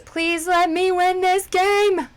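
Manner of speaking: fast then a high, loud slow plea; nervous begging to win. Real, not acted. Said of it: "I'm so nervous".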